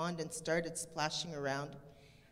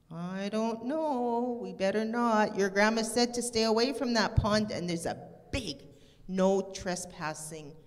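put-on cautious boy. Jeremy warning Stella about Grandma's rule, hesitant boy voice. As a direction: play the careful friend who won't break rules.

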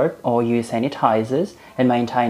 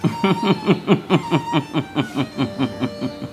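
smug laugh; 3 dB quieter than usual. Acted.

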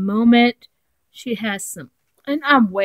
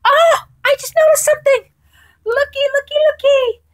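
high squeal, much higher and louder; squealing excitement; 9 dB louder, faster than usual. Real, not acted. Squealing to viewers on spotting the stamp she wanted. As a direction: shriek with sudden joy at the find. Sudden discovery in mail.

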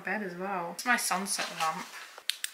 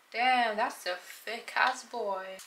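slow drawn-out; 3 dB louder, much slower than usual. Pretend.

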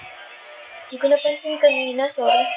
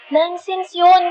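higher-pitched indignant dismissal; exasperated protest; 7 semitones higher, much slower than usual. Acted. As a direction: wave it off, annoyed. Acted quarrel, dismisses the accusation.

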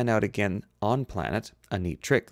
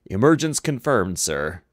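loud, raised report; shouting over alarms; 6 dB louder than usual. A narrator's reading. Helmsman reporting over sirens, shouting to be heard. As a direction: call it out over the din. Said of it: "Sulu had to shout too".

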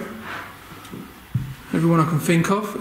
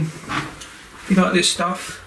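ordinary talk, nothing marked

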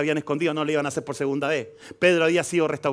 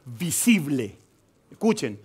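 ordinary talk

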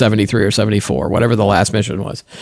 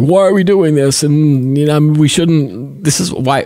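put-on old person voice; 2 semitones higher, slower than usual. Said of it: "is this my old person voice now?".

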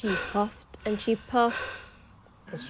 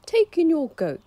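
put-on wise old sage; 9 semitones higher, 8 dB louder than usual. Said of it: "said the wise old man".

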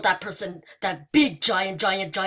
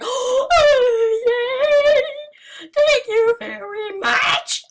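high, loud excited squeals; 14 semitones higher, 7 dB louder, slower than usual. Real, not acted. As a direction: squeal with delight. Excited game moment.

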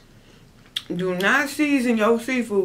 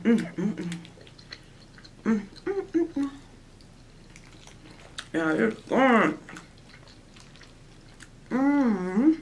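muffled words between bites; chewing while talking; 5 dB quieter than usual. Real, not acted. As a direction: mouth full of food. Seen 0: Fork of rice goes in at 0.4, then she chews through the words.

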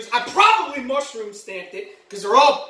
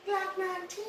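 whisper; 5 semitones higher, 14 dB quieter than usual. Acted. Listing weapons fondly.

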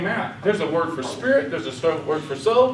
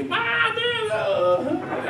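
much higher, a yelp of wonder; sudden amazed outburst; much slower than usual. Retold or imitated. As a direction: burst out in high amazement like you just saw something. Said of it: "and all of a sudden".

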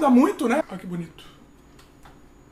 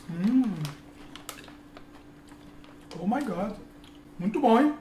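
talking between bites; chewing while talking; 4 semitones lower, 6 dB quieter than usual. Real, not acted. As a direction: mouth partly full. Seen 0: Puts piece in mouth at -1, chews closed-mouthed while speaking.

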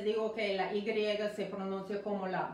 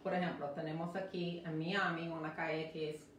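ordinary talk

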